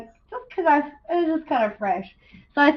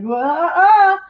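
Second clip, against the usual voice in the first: raised, louder retold yelp of shock; mock dismay; 6 semitones higher, 5 dB louder than usual. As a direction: imitate a stunned wail at bad news. Retold shock at failing homework grade.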